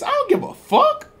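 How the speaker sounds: mock bravado squeak.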